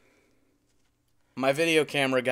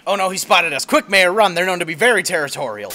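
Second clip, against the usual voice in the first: loud, high alarmed yelling; panicked shout; 7 semitones higher, 9 dB louder than usual. Acted. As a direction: yell a frantic warning to run. Narrator panics, urges escape.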